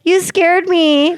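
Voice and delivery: high, drawn-out little-sister imitation; mock hurt complaint. Teenage sister to brother's girlfriend after being yelled at, mock hurt. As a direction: stretch it out high, imitating a wounded girl.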